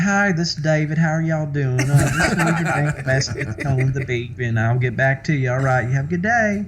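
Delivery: high, hammed-up Southern twang; old Southern accent. Mimicking his old answering machine message, exaggerated Southern accent. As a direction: imitate a cheery Southern voicemail greeting in a high voice. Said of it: "I hear the old message".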